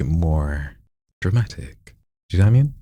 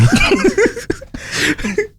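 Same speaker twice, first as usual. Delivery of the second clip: high squealing laugh. Real, not acted. Coach laughs at hum.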